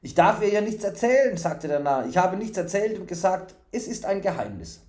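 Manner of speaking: high, bright-eyed simpleton promising food; eager fool. A narrator's reading. Fool promising the stranger food, eager.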